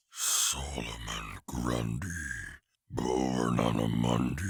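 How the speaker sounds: very low, slow monster growl; lumbering monster. Acted. Monster reciting his own nursery rhyme, low lumbering growl. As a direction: drag the rhyme out deep and heavy.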